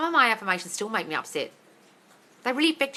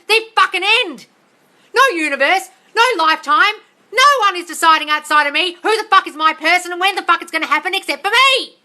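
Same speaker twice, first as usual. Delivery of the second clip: full-volume shouting, pitched way up; yelling rant; 9 semitones higher, 10 dB louder than usual. Real, not acted. Host yelling at her live viewers to take their power. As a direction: scream it at them like a furious coach. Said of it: "I've already been yelled at".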